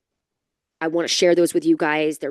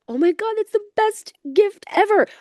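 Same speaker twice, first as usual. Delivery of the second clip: sarcastic squeal, much higher; mock delight; 3 dB louder than usual. Wife answering husband's birthday news with mock high delight. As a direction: gush falsely over a water heater repair.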